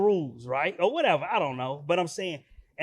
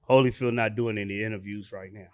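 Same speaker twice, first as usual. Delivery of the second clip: low, level imitation of a guard; security guard; 10 semitones lower than usual. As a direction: refuse in a deep mock bouncer voice. Voices the security guard refusing.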